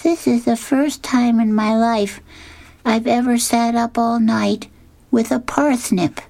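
put-on a wistful little boy; Linus. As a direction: Linus in a high childlike voice, a bit forlorn. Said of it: "he says".

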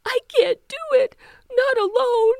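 high, loud sobbing; blubbering. Acted. Teeny crying that she can't do it alone, high and loud. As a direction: cry out that you can't. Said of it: "Teeny blubbered".

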